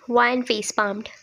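ordinary delivery, nothing marked

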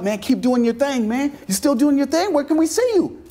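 high, excited mock New York fan; imitating New York accent. New York fans gushing about Cool Out TV, excited New York accent. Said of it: "they had New York accents".

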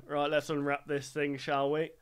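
low, soft muttering to oneself; mock muttering. Retail worker mimics a shopper unwrapping jeans, low mock muttering. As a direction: act out a shopper talking quietly through a task.

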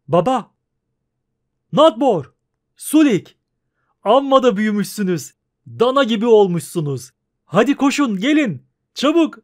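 father joyfully calling his sons. Acted. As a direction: call the sons across the yard.

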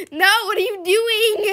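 laughing shout. Real, not acted. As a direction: yell then crack up. Another car blocking her.

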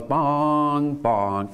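two slow chime notes stepping down; falling bong tones. Shown as a demonstration. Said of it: "that means turn left".

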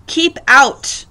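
loud storybook order; yelling. A narrator's reading. Hank ordering the tortoise away, yelling. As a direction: yell it to drive someone off. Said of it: "yelled Hank".

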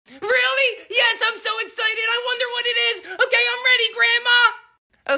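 put-on high, eager boy voice; excited kid. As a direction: squeal with excitement. Kid awaits a present.

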